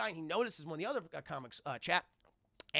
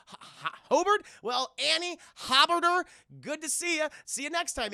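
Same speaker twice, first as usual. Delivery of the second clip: high, booming stammered farewell; loud mock-jovial; 10 semitones higher, 9 dB louder than usual. Agent mangling the boy's name while leaving, mock-jovial. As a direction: stumble loudly through the name.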